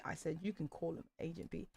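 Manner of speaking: low, soft aside; spoken song interjection. Acted. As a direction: say it low and quiet between sung lines. Spoken aside within theme song.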